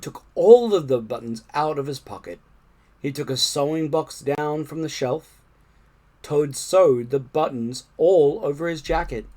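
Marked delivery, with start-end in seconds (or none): none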